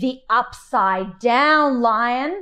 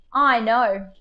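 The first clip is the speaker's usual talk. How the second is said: ordinary delivery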